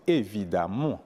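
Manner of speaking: "évidemment" with its final syllable lengthened; accent sur la dernière syllabe. Shown as a demonstration. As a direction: stress the last syllable of "évidemment". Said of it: "l'accent tonique tombe toujours sur la dernière syllabe". (« évidemment » accentué sur la dernière syllabe)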